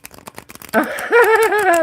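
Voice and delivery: loud high laughter; laughing hard. Real, not acted. Streamer laughing at a viewer's grandpa joke, loud high laughter. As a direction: crack up at the joke.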